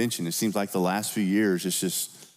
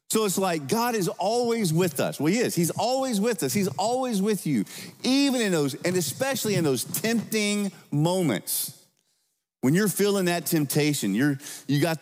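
higher, passionate preaching voice; fervent preaching; 9 semitones higher than usual. Acted. Pastor assuring congregation God is with them, fervent. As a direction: lift them with the promise. Preaching climax, high pitch.

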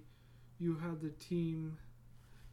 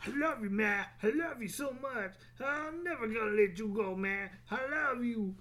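mock loving wrestler; 5 semitones higher than usual. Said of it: "putting him in the bear hug".

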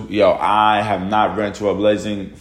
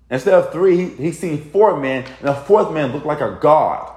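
amazed; 6 semitones higher than usual. Real, not acted. Stops reading, amazed at the story.

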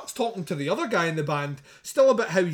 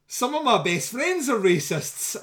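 quoting Mark; 8 semitones higher, 4 dB louder, slower than usual. Retold or imitated. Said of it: "I can hear Mark say".

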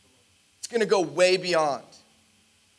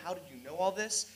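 very soft retold question; hushed amazement; 2 semitones lower, 13 dB quieter than usual. Stunned schoolmate asking Patrick, hushed amazement. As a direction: drop to a hush, imitating someone stunned.

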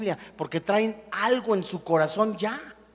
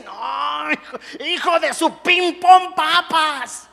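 higher, louder hammed-up cursing, slowed; mock angry outburst; 7 semitones higher, 5 dB louder, slower than usual. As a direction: sputter a cleaned-up curse. Mock cursing after an offense.

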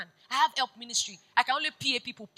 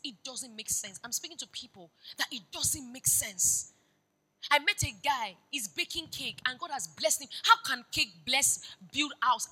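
ordinary delivery

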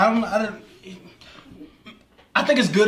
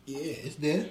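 talking through hiccups; 4 dB quieter, much slower than usual. Real, not acted. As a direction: hiccups breaking the words. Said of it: "You got the hiccups".

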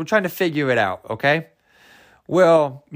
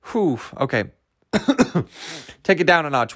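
ordinary talk

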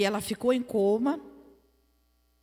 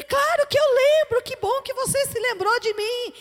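much higher, louder burst of joy; delighted reply; 13 semitones higher, 7 dB louder than usual. Retold or imitated. Friend answering the woman who remembered her, retold delight. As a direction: re-enact an overjoyed answer on the phone. Acted joyful reply on phone.

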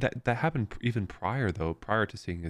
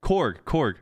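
Korg, Korg said higher and louder, realizing; realizing the name; 7 semitones higher, 4 dB louder than usual. Real, not acted. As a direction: repeat Korg in surprised recognition. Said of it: "the actual rock person is named Korg".

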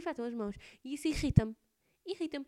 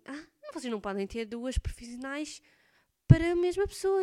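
impatient tester telling her off, louder at the end; scolding complaint; 3 semitones higher, 9 dB louder than usual. Retold or imitated. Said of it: "começou a reclamar comigo".

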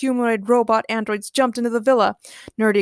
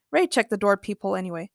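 ordinary delivery, nothing marked

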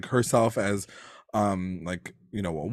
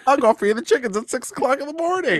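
put-on much higher, eager farm-boy voice; mock amped Archie; 12 semitones higher, faster than usual. Podcaster imitates Archie eager for chores, much higher. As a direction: squeal it like a thrilled kid.

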